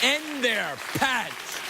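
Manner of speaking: very slow, dragged-out punchline; milk every word of it. Retold or imitated. Host to audience, replaying the punchline very slowly. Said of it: "I said".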